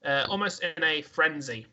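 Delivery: ordinary talk, nothing marked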